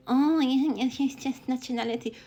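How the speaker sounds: mock dismissive voice. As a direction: toss it off like a smug critic. Mocking a dismissive opponent.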